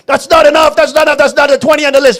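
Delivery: high, loud wife's tirade; mock nagging outburst. Imitating wife rejecting husband's batteries, loud mock fury. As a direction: shout him down, rapid and relentless. Wife nags back in acted quarrel.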